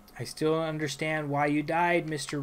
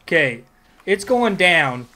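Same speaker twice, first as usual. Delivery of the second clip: pumped up; 7 dB louder than usual. Acted. Gamer psyching up for fight.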